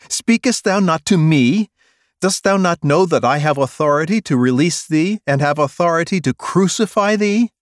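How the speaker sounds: loud high commanding questions; Pilate demanding. Acted. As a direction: assert power over the prisoner. Pilate presses Jesus.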